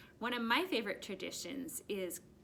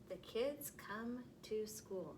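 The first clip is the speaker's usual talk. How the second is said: slower and softer reading; 7 dB quieter, slower than usual. Shown as a demonstration. Teacher rereading the sentence softly to first graders. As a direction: read it again softly and slowly. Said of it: "next sentence".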